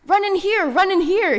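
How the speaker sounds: high, loud shrieked call; fox's scream. Acted. Foxy Loxy luring the friends into his cave, screaming. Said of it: "he screamed".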